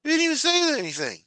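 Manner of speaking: high, fast, indignant outburst. Real, not acted. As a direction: blurt it out high and fast in disbelief. Repeating indignantly.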